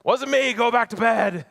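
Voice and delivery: grumpy Eli. Acted. Eli sends Samuel back to bed, loud and irritated. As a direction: brush the boy off, cranky at being woken. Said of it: "At least that's how I would say it".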